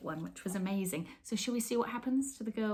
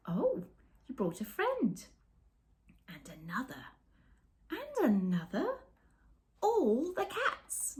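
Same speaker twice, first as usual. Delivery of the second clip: hushed then high, slow wonder as more arrive; growing amazement; 6 semitones higher, slower than usual. A narrator's reading. Reading to children as cat after cat arrives, growing amazement. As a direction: build from a whisper to awe. Reads picture book aloud.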